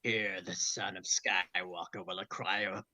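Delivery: low, slow sinister emperor; Palpatine hologram. Acted. The Emperor's recording speaks to Vader, low and sinister.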